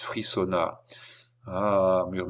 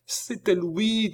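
high, drawn-out alarm; name him in a high, slow cry; effroi; 12 semitones higher, slower than usual. A narrator's reading. Girl cries out recognising intruder. (effroi, voix très aiguë)

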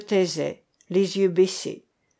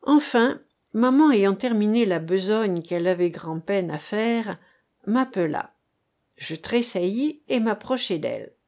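ordinary delivery, nothing marked

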